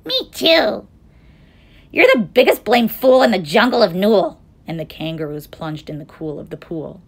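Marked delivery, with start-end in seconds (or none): 0.0-4.4 loud, high jeering youngster; young kangaroo. A narrator's reading. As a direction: jeer along noisily. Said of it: "young kangaroo in her pouch said".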